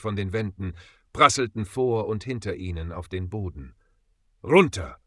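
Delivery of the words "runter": sharp loud yell to take cover; shouting. A narrator's reading. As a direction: bellow the warning. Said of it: "brüllte Ezekiel".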